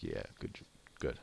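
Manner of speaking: deadpan fake congratulations; sarcastic reply. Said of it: "I'm like".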